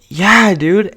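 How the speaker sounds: high, loud eager yes. Retold or imitated. Boy answering mom's McDonald's offer, high and eager. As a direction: jump at the offer.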